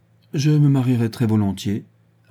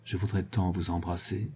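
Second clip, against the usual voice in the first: soft and lower, caressing; 3 semitones lower, 9 dB quieter than usual. A narrator's reading. Guibray confessing desire to the marquise, soft caress. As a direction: hush it down, stroking each word. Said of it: "de cette voix caressante".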